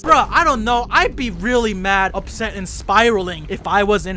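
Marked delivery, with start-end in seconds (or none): none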